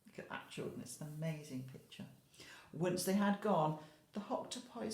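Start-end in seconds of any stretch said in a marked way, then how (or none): 0.1-2.0 whisper. Real, not acted. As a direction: whisper it like sharing a secret. Teacher shows picture to children.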